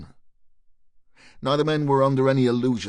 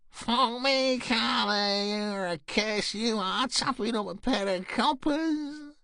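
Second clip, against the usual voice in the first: much higher cackling streetwalker voice; screechy blonde; 11 semitones higher, slower than usual. Acted. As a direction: screech it out, high and mocking. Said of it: "screeched with laughter".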